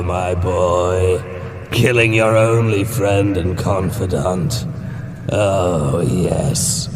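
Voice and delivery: slow, gloating demonic voice; taunting creature voice. Acted. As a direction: drawl out each cruel word.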